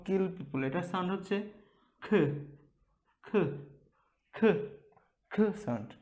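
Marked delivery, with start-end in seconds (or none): none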